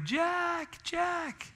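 high calling shout; calling the dog. Retold or imitated. Imitating wife calling the dog down the driveway, high shout. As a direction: holler after the dog down the hill. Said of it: "She's walking down our driveway".

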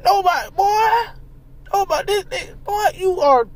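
high, incredulous disbelief; can't-believe-it rant at a man near fifty; exasperated. Real, not acted. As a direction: pitch up like you can't believe it.

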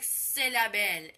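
long, slow, loud Cinnamon; grandma's stern command. Retold or imitated. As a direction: drag out the word slowly and loudly. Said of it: "She's like".

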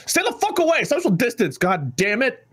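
high-pitched, indignant scolding; exasperated outburst. Acted. Scolding listeners about social distance, high and exasperated. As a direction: burst into a high exasperated rant.

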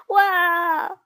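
loud, high acted wail; wailing cry. A narrator's reading. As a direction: bawl like a weepy schoolboy. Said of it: "wailed Weepy William".

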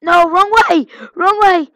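loud, high frustrated shout; frustrated yell. Real, not acted. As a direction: yell it at the screen, annoyed. Yelling at game going wrong.

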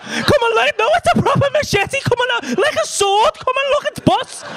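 high-pitched, gushing excitement; giddy, very high delivery; overexcited. Retold or imitated. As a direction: gush over it at the top of your range. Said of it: "I was more excited than I should have been".

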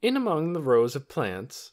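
usual speaking voice

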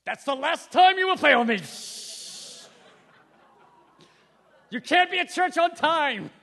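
Darth Vader menace. Retold or imitated. A harsh discipler berates a failing brother, mock Vader. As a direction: scold like a Sith lord. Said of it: "we feel like Darth Vader".

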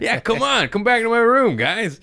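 high, loud come-on to the guys; hammed-up shouted welcome; mock eager invitation. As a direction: call them over with exaggerated enthusiasm. Sarcastically inviting possible murderers to room.

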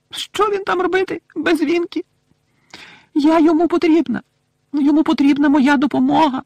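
distraught little elf squealing with grief; tearful wailing elf. A narrator's reading. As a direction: wail it in a piercing elf voice. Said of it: "знову заливаючись слізьми".

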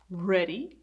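ordinary delivery, nothing marked

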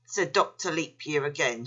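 ordinary delivery

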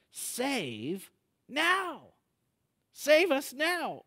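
high, drawn-out cry for rescue. Acted. Preacher voicing what Hosanna means, crying out. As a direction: call out the plea loud and slow.

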